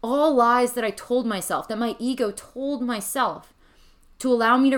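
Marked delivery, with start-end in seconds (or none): none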